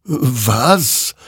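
high, wondering question; puzzled. A narrator's reading. Soldier baffled by the pointing figure, puzzled. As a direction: swoop the single word up in wonder. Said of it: "wunderte sich dieser".

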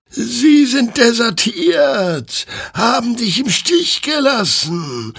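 very high, very slow otherworldly chant; slow eerie choir of souls; chorus of flaming souls. A narrator's reading. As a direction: intone it as a ghostly choir. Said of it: "erklärten die flammenden Seelen ihm im Chor".

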